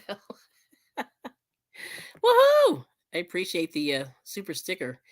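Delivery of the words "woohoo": high, loud whoop; real high-pitched burst of delight; excited cheer. Real, not acted. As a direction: let out a celebratory whoop. Cheering for a super sticker.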